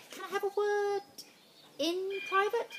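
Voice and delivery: high, far-off teacher voice. Acted. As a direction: play the teacher, pitched well up.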